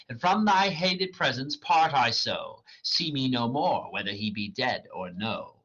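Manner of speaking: dramatic reading of verse; reading Shakespeare aloud. Acted. Reader voicing Hermia rejecting Demetrius, dramatic. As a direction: read the lines as the character, measured.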